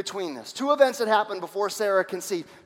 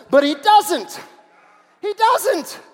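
amused emphasis; 11 semitones higher, 10 dB louder, much slower than usual. Real, not acted. Preacher laughing at Abraham.

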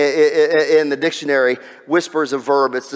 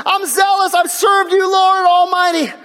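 high, distraught prophet pleading with God; high-pitched, hammed-up despairing rant; Elijah; 10 semitones higher, 3 dB louder than usual. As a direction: cry out in loud, desperate complaint. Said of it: "And Elijah says this. He says".

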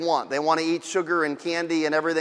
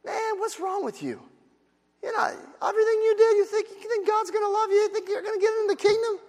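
much higher, fast incredulous sneer; mock scorn; 13 semitones higher, 5 dB quieter than usual. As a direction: play the scoffer, laughing him off. Imagined scornful reply.

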